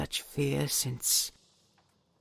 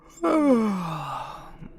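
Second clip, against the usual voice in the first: loud, high sigh tailing off; big weary sigh; 6 semitones higher, 8 dB louder than usual. Acted. Dragon sighing as she gets up from resting. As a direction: let out a long heavy sigh.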